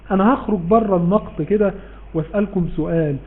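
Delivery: ordinary delivery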